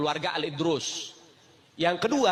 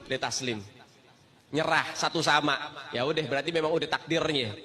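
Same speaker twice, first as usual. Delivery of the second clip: ordinary talk, nothing marked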